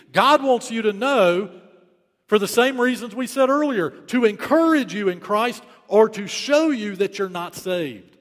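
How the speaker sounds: loud, high, urgent pulpit delivery; forceful preaching. Real, not acted. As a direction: drive the warning home to the congregation.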